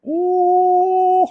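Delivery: very high, drawn-out ooh of delight. Real, not acted. Showing viewers the new Jingle Bell Sipper, with a delighted ooh. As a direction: coo at the cute cup.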